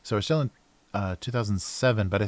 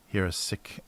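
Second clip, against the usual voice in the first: ordinary talk, nothing marked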